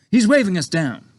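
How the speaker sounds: a hint of a breezy young officer; young pilot. A narrator's reading. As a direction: pilot answering his boss, lightly voiced breezy officer. Pilot voiced in read story.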